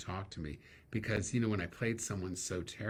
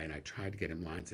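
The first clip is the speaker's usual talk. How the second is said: whispered; 2 semitones lower than usual. Real, not acted. Repeats the line as a whisper.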